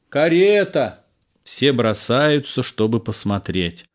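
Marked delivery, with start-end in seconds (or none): 0.1-1.0 high, ringing lookout's call; sentry calling out. A narrator's reading. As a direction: announce it from a distance. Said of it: "Часовой с откоса".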